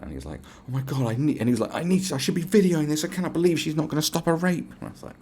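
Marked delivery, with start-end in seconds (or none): 0.7-4.7 high, overdramatic disbelief; over the top. Retold or imitated. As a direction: mimic an outraged drunk friend, pitched up. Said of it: "he was being really O T T".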